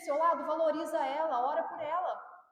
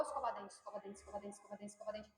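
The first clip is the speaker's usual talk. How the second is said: lower, much softer repetition that fades away; fading mantra; 7 semitones lower, 13 dB quieter, faster than usual. Shown as a demonstration. Mother repeating escova dente to a child, fading to a murmur. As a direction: let the repeated escova dente fade almost to nothing. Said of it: "Inculca é fala, fala, fala".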